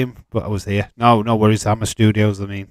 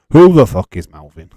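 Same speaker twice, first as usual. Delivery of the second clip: surprised outburst; 9 dB louder than usual. Real, not acted. Unknown name in the league table.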